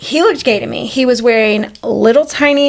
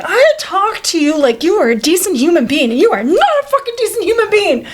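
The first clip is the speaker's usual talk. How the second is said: retold outrage; 5 semitones higher than usual. Woman retells telling off the beach flasher, acted outrage. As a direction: scold him in a high, wounded fury. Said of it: "I'm so mad because I was like".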